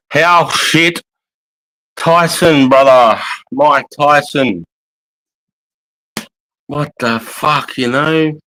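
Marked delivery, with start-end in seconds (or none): none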